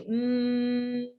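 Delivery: lips closed steady humming; steady hum. Shown as a demonstration. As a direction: hum on one note. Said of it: "The humming, you'll just be".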